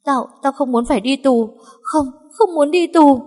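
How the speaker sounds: rising to a high frantic cry; panicked. Acted. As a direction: terror of prison takes over. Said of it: "Tâm trạng vừa hoảng loạn vừa hoang mang".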